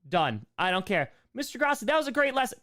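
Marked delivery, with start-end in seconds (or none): 1.3-2.6 high, loud gushing pupil voice; mock praising student. Middle schooler praising the teacher's lesson, mock gushing. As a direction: pipe it up high like an eager schoolkid.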